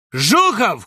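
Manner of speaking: loud, high-pitched call; shout. A narrator's reading. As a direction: shout the surname out accusingly. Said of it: "крикнул Бобров из-под сосны". (крик)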